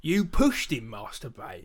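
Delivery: mock old East London accent, over the top; Michael Caine. Co-host mimicking Alfred's over-the-top delivery, exaggerated Cockney impression. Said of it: "Michael Caine's making fun of himself as a Cockney".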